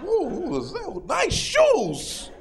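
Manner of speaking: high nosy voice peeking under the stall; a snooping neighbor admiring shoes; mock nosy coo; nosy bathroom stranger.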